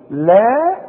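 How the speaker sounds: "لا" with the long aa held and rising; two-count madd. Shown as a demonstration. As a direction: stretch "لا" upward in pitch. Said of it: "حركتين".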